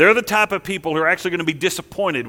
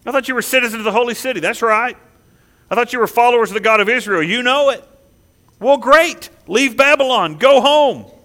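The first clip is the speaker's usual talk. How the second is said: mock challenge; 5 semitones higher, 5 dB louder than usual. God pressing the challenge.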